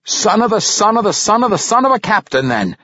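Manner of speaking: high, celebratory dwarf voice; congratulating. A narrator's reading. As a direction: congratulate with gusto, like a toast. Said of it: "Parson Glaive congratulated".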